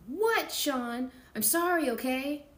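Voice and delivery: high, loud fed-up reply; exasperated. Acted. Kim snapping back at Sean, exasperated. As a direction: snap it back impatiently.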